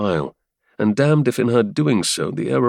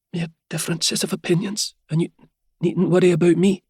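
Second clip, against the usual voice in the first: Helia voice; 9 semitones higher than usual. Acted. Helia voiced, higher woman.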